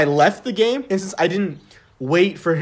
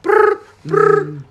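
much higher, louder brr brr of a ringing phone; phone ring imitation; 13 semitones higher, 5 dB louder than usual. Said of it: "I hear a phone call".

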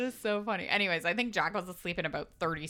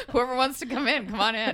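laughing impression; 4 semitones higher, 7 dB louder than usual. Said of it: "You were like".

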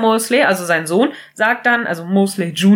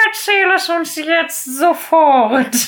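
higher, slow, insistent demand; mock group pressure, high and slow; pushy demand; 7 semitones higher, slower than usual. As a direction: press her to tell it now, drawn out. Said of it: "so richtig".

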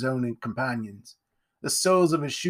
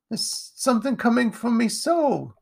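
high, plaintive urchin voice; pleading child; upset young boy; 11 semitones higher than usual. A narrator's reading. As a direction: insist on it in a high boyish voice. Tearful boy answers the bully.

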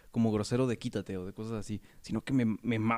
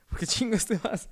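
much higher mother's scolding through laughter; angry mom, laughing; 9 semitones higher than usual. Retold or imitated. Mother scolding her son for leaving, high voice with laughter. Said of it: "Y me dice".

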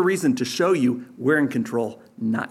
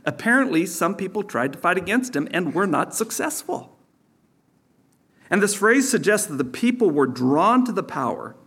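ordinary delivery

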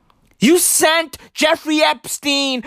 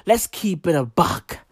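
ordinary talk

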